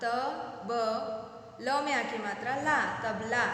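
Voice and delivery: तबला built up as तब, then ला, then तबला; spelling out syllables. Shown as a demonstration. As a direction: build the word तबला piece by piece. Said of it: "इस तरह से पढ़ सकते हैं".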